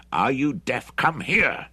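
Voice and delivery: impatient order. A narrator's reading. Bruce orders the gloating Hendry to come, impatient. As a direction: order him over, losing patience.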